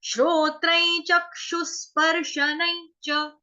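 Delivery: श्लोक line read slowly; slow recitation. Shown as a demonstration. Teacher reading a verse for the class, slow. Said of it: "अब इसका उच्चारण देखो".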